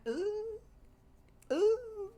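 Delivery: high-pitched, drawn-out ooh. Real, not acted. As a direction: coo a scandalized ooh, way up high. Reacting to Summer's nasty side.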